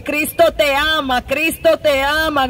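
ordinary talk, nothing marked

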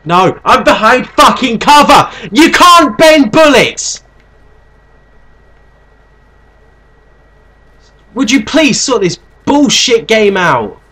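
high loud indignant yelling; raging gamer. Real, not acted. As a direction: rant furiously at the game. Shot through cover, raging.

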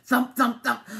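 loud, separate beats; suspenseful storybook thumps; thudding. A narrator's reading. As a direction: punch each word like a heavy footstep. Narrator voices thumping sound.

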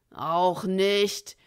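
loud, threatening question; menacing outlaw. A narrator's reading. Bill pressing the crowd, loud and menacing. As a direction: lean on them hard.

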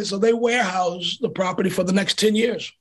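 ordinary talk

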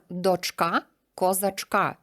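slow, дочка and козачка both stressed on ка; rhyme stress. Shown as a demonstration. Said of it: "ці два слова теж римуються". (повільно, наголос дочкА)

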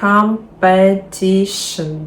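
"Competition" slowly, stress on TI; compe-TI-tion, drawn out syllable by syllable; stress before -tion. Shown as a demonstration. Teacher showing a long word, slow stress on TI in "Competition". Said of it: "наголос не на перший склад".

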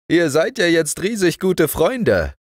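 put-on old doctor greeting the girl, loud and high. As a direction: beam at the child as the kindly doctor.

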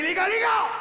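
high-pitched call to the lads; excited calling out. Acted. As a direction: rush in calling out to your mates. Accourt appeler ses copains. (appel excité aux copains)

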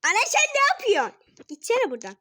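high, loud shout at mom; exasperated yell. Real, not acted. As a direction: yell it out, annoyed. Yelling at mom mid-game.

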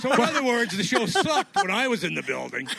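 mock indignant. Real, not acted. Joking self-mockery.

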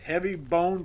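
usual speaking voice

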